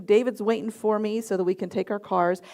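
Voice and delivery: ordinary talk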